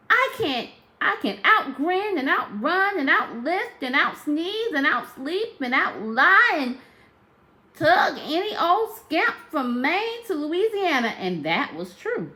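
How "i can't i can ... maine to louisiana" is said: high, loud, rapid tall-tale boast; boastful brag. Acted. Sally Ann bragging to anyone who tried, high and loud. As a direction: reel off every brag with pride. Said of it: "she told anyone who tried".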